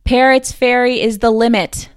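booming call from high above; booming shout. A narrator's reading. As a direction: shout it out so it carries down the canyon. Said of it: "shouted in a booming voice".

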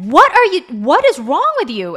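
retold scolding, loud and high; exasperated retort. Mom answers her yelling daughter in front of neighbors, exasperated. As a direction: demand it like a startled, exasperated parent.

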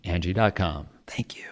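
soft whispered sign-off; whispering. Acted. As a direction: breathe it as a whisper.

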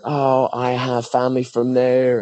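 playfully mimicked delight; mock enthusiasm. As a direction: imitate a gushing tourist, stretched out slow. Mimicking Americans claiming Irish family.

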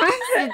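sudden high laugh; short laugh over imagined doom; nervous burst of laughter. Real, not acted. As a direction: laugh it out in disbelief. Laughing at her own panic.

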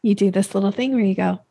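usual speaking voice

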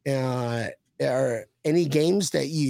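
ordinary delivery

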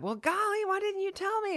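high, surprised friend voice; mock surprised friend. Friends asking the songwriter about the radio hit, mock surprise. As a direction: ask it in cheerful disbelief.

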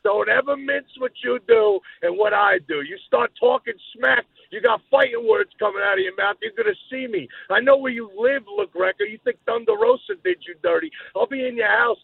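high, loud menacing threats; wrestling promo. Acted. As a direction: threaten him like a heel. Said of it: "cut a promo on you".

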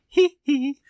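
squeaky hee hee giggle, loud; silly high laugh. Pretend. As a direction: giggle like a cartoon character. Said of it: "This is how I'm gonna laugh from now on".

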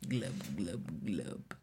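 low gurgling glub noises; fish gurgle. Pretend. Swimmer in bathwater joke.

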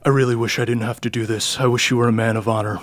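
lightly voiced villain, quick and understated; a hint of regretful menace, spoken briskly; imposing, regretful villain. Plays the villain.

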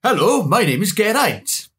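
slow, cheerful Welsh greeting; upbeat Welsh NPC voice; Welsh accent. Pretend. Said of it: "if there's voice acting and it's like".

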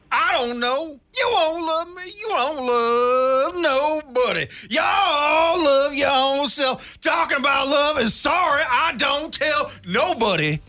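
high, slow, sassy whining with a cry; like Foghorn Leghorn, sassy and wailing; sassy whiny kid. Acted. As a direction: pout it out like a sulky, sassy child. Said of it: "a top-tier thespian is lots of sass".

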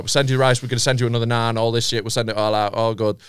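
eager apology. Retold or imitated. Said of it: "groveling apologies".

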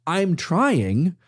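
high-pitched, hurried noes easing into slow, deliberate correction; emphatic protest. Acted. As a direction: protest high up, then spell it out slowly. Imagined protest to the linguist.

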